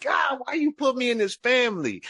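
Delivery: high-pitched angry plea to heaven; retold outcry of protest; mad at God. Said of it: "be mad at God".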